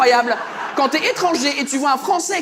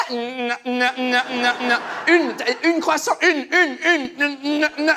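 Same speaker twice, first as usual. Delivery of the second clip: stuck repeats of "une", growing louder; glitching stammer; 2 semitones higher, 3 dB louder, slower than usual. Acted. Bakery woman bugging at a mistake, glitchy stammer. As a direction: stutter like a jammed machine. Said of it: "elle a bugué".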